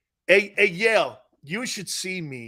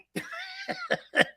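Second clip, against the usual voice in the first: high wheezing laugh; 3 dB quieter than usual. Real, not acted. Laughing at himself.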